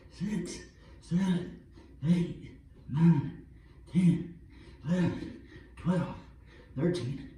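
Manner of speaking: counting reps while exercising; strained counting mid push-up; doing push ups. Real, not acted. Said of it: "do the twenty-five push-ups".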